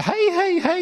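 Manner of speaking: high, eager はいはいはい; high, glad mock grandma reply; happily coming. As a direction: answer like a delighted grandma rushing over. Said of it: "って嬉しそうに来るんですよ".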